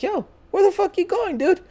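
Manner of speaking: high-pitched, incredulous mock call; retold calling out. Calling after friend walking toward it, high mock alarm.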